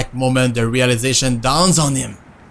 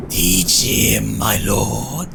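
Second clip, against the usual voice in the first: lower, drawn-out villain voice; evil voice; 3 semitones lower, much slower than usual. Pretend. Player egging on the lord, trying an evil voice. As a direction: put on a low evil voice. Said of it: "do an evil voice".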